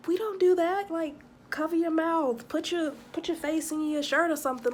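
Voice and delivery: high exasperated scolding. Retold or imitated. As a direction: scold them like a parent. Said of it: "and I'm just like".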